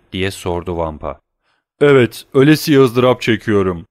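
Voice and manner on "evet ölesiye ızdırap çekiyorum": loud, pained prisoner voice; loud agonized reply; agonized answer. A narrator's reading. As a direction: groan out your suffering.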